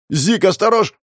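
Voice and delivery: high shouted warning, cut short; panicked cry to watch out; urgent warning. Acted. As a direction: call out in alarm to a friend in danger.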